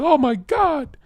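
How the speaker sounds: high-pitched imitation of an amazed woman. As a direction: gasp it high, as her awed reaction. Woman reacting amazed, quoted.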